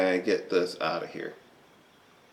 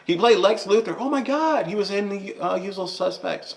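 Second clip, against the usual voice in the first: much higher voice, straining to remember; excited recall; 11 semitones higher than usual. Real, not acted. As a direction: blurt it out high as memory returns. Sudden recall while groping for a name.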